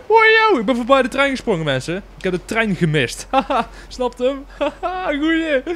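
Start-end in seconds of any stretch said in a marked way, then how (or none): 0.1-1.6 high, loud excited whoop; loud gleeful boast; thrilled. Real, not acted. Thrilled at jumping past train. 3.3-5.6 high laughing over a pun; pleased high laughter; laughing at own joke. Real, not acted.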